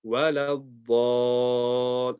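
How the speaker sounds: "Wa la ddal" with light "wa la" and a long, heavy "ddaal"; thin "Wa la", then swell into a held dark "ddal"; slow recitation of "Wa la ddal" with the Dad drawn out; light waw-lam, heavy Dad. Shown as a demonstration. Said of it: "the dad will be heavy".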